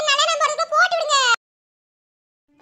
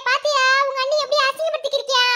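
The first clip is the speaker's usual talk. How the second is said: usual speaking voice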